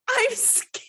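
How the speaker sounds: high, small mock-frightened voice; mock scared squeak. Reading her panicked text to her husband, mock scared. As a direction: play up the fright for laughs.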